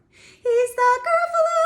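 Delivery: high, loud sung climax; big high ending. Shown as a demonstration. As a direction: sing the final line big. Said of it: "Here's the ending part".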